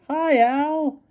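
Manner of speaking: put-on high girlish voice; cheeky greeting. A narrator's reading. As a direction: call hi to the coach. Kathy greeting the coach.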